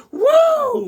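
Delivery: very high falsetto woo. Retold or imitated. Host imitating [name], falsetto whoop. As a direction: squeal it like a pop star.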